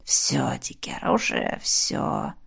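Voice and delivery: slow, faint, breathy whisper. A narrator's reading. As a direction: barely breathe the words. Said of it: "шепнула".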